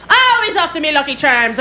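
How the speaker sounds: Irish leprechaun-style teasing; mock Irish brogue. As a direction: tease in a put-on Irish cereal-ad accent. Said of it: "used to make fun of him".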